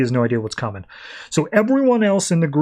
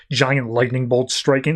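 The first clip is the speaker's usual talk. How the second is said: ordinary delivery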